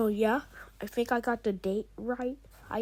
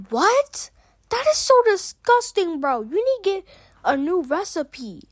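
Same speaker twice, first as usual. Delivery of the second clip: high, loud disgusted yell; disgusted shout; 8 semitones higher, 6 dB louder than usual. Acted. Disgusted outburst at cannibal chicken.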